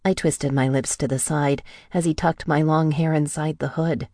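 plain reading voice

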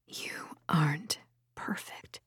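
very soft, whispered undermining thought; ugly inner whisper. A narrator's reading. An ugly voice from deep in her thoughts, whispering. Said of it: "an ugly voice whispered".

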